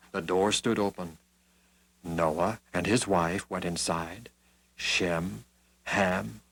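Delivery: ordinary delivery, nothing marked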